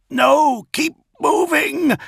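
very high, drawn-out urgent yell; strained, frantic shouted command. A narrator's reading. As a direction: yell to keep him alive. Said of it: "Dantiok yelled".

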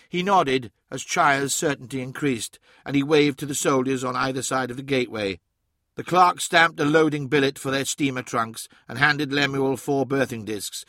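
plain reading voice

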